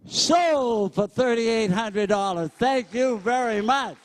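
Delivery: brisk, punchy auctioneer chant, pitch up; auctioneer bid calling. Acted. Auctioneer working the crowd at a school charity, brisk bid calling. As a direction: sell it to the room, count the bids out.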